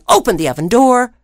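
ordinary delivery, nothing marked

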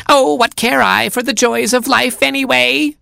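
very high, theatrical fox wail; hammed-up vixen lament; foxy scream. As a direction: mockingbird shrieking as a fox for the hounds. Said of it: "screamed the mockingbird in a foxy voice".

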